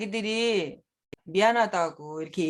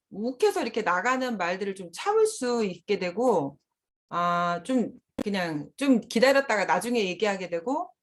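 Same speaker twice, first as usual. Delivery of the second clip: ordinary talk, nothing marked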